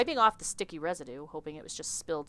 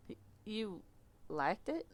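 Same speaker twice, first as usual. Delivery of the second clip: stunned Southern; 3 semitones higher, 8 dB quieter, slower than usual. Acted. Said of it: "I don't know why he's Southern".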